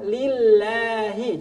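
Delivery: Lillahi drawn out slowly; slow thin Lillahi. Shown as a demonstration. Teacher models Lillahi for students. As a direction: stretch Lillahi with a thin lam.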